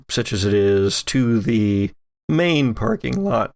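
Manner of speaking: usual speaking voice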